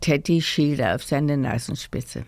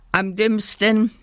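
higher, cross exclamation; mild shout; 6 semitones higher than usual. A narrator's reading. Teddy teacher condemns Kasper, mildly shouted. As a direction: cry out the verdict, raised pitch. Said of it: "schrie der Teddy".

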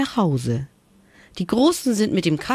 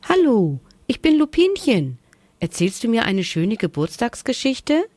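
put-on high, bright little bunny girl; high little bunny voice asking for a story; 7 semitones higher than usual. A narrator's reading. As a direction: chirp it up high like a small bunny. Bunny Lupinchen introduces herself.

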